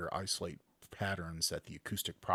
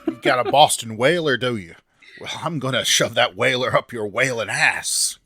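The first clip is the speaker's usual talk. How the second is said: loud, higher hammed-up Boston accent; 4 semitones higher, 13 dB louder than usual. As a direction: bellow it like a furious Boston fisherman. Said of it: "my accents are terrible".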